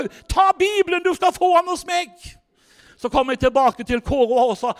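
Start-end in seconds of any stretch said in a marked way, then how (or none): 0.3-2.1 much higher, louder, gushing with joy; overjoyed giving. Retold or imitated. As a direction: hand it over in delighted excitement. Moved shop lady gives Bible.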